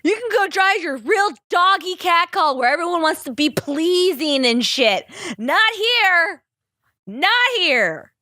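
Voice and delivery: real teary, giggling voice; tearful laughter. Real, not acted. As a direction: cry and laugh at once, still emotional. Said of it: "We're all crying with you".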